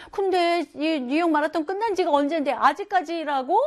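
high, incredulous question; puzzled disbelief. Retold or imitated. Family doubting dad is still running, high and incredulous. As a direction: ask it as if it can't be true. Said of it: "뭐 이러면서".

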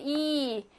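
이 held as a long "ee". Shown as a demonstration. Teacher explains the stressed vowel, 이 held long. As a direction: stretch 이 out. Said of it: "강세를 받으면 길게".

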